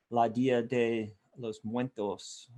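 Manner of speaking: "La Dia de los Muertos" said slowly in careful, unsure Spanish; Spanish pronunciation. Shown as a demonstration. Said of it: "I think that's how you say it".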